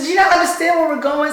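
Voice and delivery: high and fast, exasperated; incredulous snap. Retold or imitated. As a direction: fire back in disbelief. Exasperated retort.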